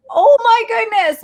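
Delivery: high, loud retold gasp of disbelief; amazed reaction. Retelling her reaction to the shout-out, amazed.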